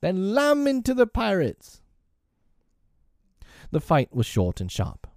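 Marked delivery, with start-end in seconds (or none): 0.0-1.8 put-on very high boyish war cry; gleeful high-pitched command. A narrator's reading. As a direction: send the boys charging with a high, merry call.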